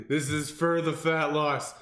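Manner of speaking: loud, high strained self-motivation; hammed-up cry of pushing through agony; mock dying runner. Trainer imitating a runner dying by the road, loud mock desperation. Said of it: "you're dying on the side of the road".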